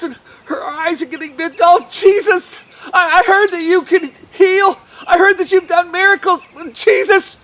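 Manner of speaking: loud, high and urgent; desperate pleading. Acted. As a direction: beg for your daughter's life. Desperate father pleads with Jesus.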